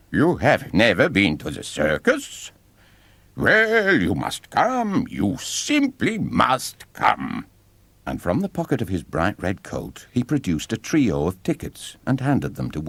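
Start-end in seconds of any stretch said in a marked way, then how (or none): 0.1-7.4 hammed-up astonishment, then a slow, higher insistence; mock surprise. A narrator's reading. Ringmaster insists the boy must come, mock astonished. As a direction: play the shock big, then coax slowly. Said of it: "staggered back in mock surprise".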